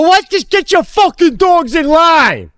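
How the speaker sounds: high, drawn-out furious yell; angry shout. Retold or imitated. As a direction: cut him off with a yelled order. Retold shouting match with neighbour.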